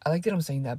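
ordinary talk, nothing marked

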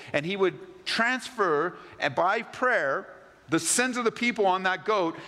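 rising sermon delivery building to the scapegoat; fervent preaching. Acted. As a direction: preach it out with full force.

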